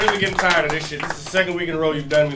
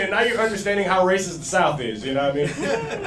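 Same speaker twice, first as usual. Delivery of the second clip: ordinary talk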